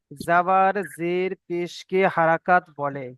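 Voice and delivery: slow, drawn-out read-aloud; reading the rule. Shown as a demonstration. Teacher reading rule one to the class, slow and measured. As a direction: read it out slowly, word by word. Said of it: "এখানে লেখা আছে এক নম্বর".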